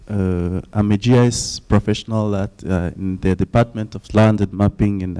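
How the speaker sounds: ordinary delivery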